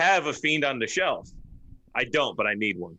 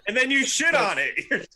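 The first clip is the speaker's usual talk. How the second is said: higher, laughing tease; 12 semitones higher, 4 dB louder than usual. Real, not acted. Teasing while laughing.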